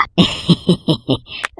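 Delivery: soft breathy villain chuckle; sly greedy snicker; villainous laugh. Acted. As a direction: chuckle to yourself over the loot. Thief gloats over stolen idol.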